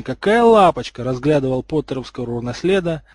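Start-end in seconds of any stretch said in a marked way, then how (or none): 0.0-1.0 high, loud gushing cry; loud adoring exclamation, pitched up; delighted yell. A narrator's reading. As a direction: squeal over something adorable. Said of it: "Кто-то с воплями".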